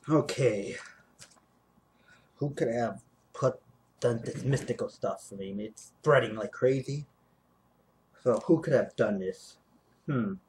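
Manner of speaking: low, soft, scheming mutter; scheming villain voice. Acted. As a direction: plot quietly like a cartoon villain. Low far voice after outro, sketch.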